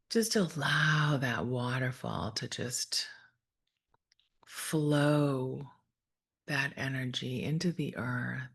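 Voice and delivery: calm, measured meditation voice with long pauses; guided meditation. Real, not acted. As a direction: gently guide listeners through breath and imagery, unhurried.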